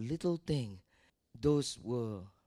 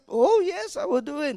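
put-on eager child's reply; 12 semitones higher, 8 dB louder than usual. Child answering dad.

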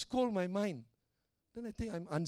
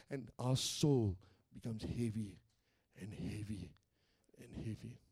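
weighed down; 8 semitones lower, 4 dB quieter than usual. Real, not acted. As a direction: let the voice sink softer with each repeat. Voice sinks with growing heaviness.

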